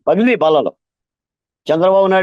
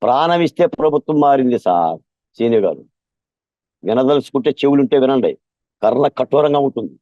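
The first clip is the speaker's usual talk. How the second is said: ordinary delivery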